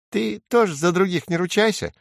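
high little sparrow voice; high-pitched, defiant sparrow; stubborn sparrow. A narrator's reading. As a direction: dig in as a small bird. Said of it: "упирается воробей".

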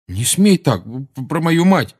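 indignant warning, stumbling over the words; angry. A narrator's reading. Seamus defending his mother to Harry, angry. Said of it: "рассердился Симус".

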